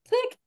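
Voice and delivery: clicking sound. Retold or imitated. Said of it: "imitating a door lock sound".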